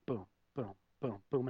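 low, soft falling thuds; hushed low sound effect; sinking booms. Retold or imitated. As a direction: drop each boom quietly, like something sinking. Voices her countenance falling step by step.

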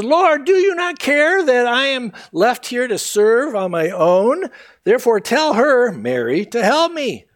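high, loud, exasperated complaint; a fed-up sister demanding help; flustered Martha. Acted. Martha complains to Jesus about serving alone, high and exasperated.

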